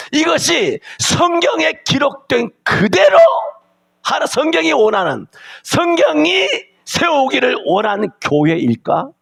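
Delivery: high, slow, crying preacher's shout; fervent preaching. Acted. Pastor to his congregation, crying out a high, slow preaching shout.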